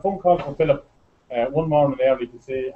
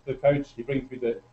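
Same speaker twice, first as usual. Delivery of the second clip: usual speaking voice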